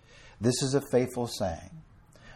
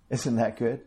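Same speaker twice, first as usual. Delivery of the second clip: ordinary talk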